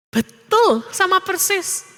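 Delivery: usual speaking voice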